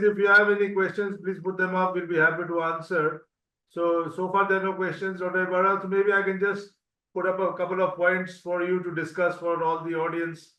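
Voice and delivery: ordinary delivery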